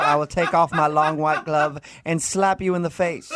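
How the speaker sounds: put-on slow, offended Southern-lady accent through laughter; host mocking the date in an offended Southern belle accent. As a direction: play a scandalized Southern belle, hammed up. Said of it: "really cute Southern accent".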